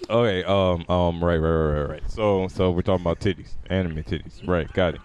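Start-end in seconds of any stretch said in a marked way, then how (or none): none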